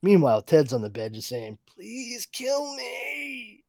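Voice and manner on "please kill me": much higher, very slow, strained plea; pleading victim. Retold or imitated. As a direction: beg for death like a broken man. Victim begging on the bed.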